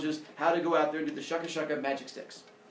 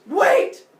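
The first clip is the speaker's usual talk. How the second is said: much higher, louder cry of wait; sudden shout; 14 dB louder than usual. Acted. Zeus cuts Prometheus off, shouting that there's more. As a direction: cut him off with a yell.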